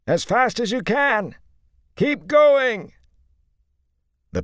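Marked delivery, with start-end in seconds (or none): 0.0-2.9 very loud, high urgent yell; desperate shout. A narrator's reading. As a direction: scream the warning across the distance. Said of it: "he shouted at the top of his lungs".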